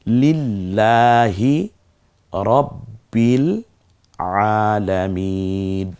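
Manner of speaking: slow chanted recitation with vowels drawn out; ধীরে তিলাওয়াত; slow Quranic recitation. Shown as a demonstration. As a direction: recite it slowly as a demonstration for the class. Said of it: "একটু পড়ে দেখি".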